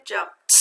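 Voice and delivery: "Jumped" with a released t at the end; unvoiced ending. Shown as a demonstration. Teacher recalls the sound for students, "Jumped" with unvoiced t. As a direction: separate the voiceless t that ends "Jumped". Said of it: "what sound did it make again".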